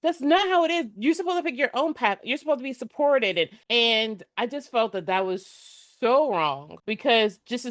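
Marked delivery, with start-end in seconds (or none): 0.0-3.4 higher, indignant retelling of her outrage; indignant protest. Retold or imitated. To her friend about the unfair coach, retold indignation. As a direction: protest how wrong it was, half-mock. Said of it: "I was so mad".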